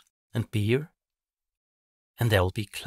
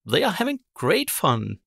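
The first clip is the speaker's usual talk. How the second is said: much higher, louder bird voice; chirpy magpie; 8 semitones higher, 5 dB louder than usual. Acted. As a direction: chirp back at the witch. Magpie reporting.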